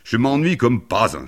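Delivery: loud, high complaint; high-pitched boyish outcry; bored boy exclaiming. A narrator's reading. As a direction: call out in bored impatience. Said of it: "s'écria le garçon qui s'ennuyait".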